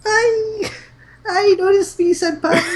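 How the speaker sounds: very high squealing fangirl voice; excited anime fan. Pretend. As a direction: squeal at your senpai. Fangirl calling senpai.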